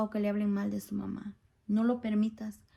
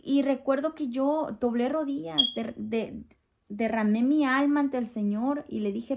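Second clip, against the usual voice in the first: ordinary delivery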